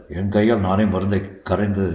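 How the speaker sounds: ordinary delivery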